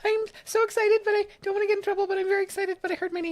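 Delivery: very high, fast, giddy dog voice that eases down toward the end; voicing the excited dog. Voicing the dog Bella after she hears her name, high and giddy. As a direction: squeal it out as an overjoyed puppy trying to behave. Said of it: "She's like".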